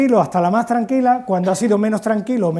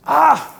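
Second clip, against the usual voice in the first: a short, higher, louder yelp of pain; mock cry of pain; 7 semitones higher, 4 dB louder than usual. Anakin Skywalker losing his hand, a mock yelp of pain. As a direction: yelp as if your hand were cut off.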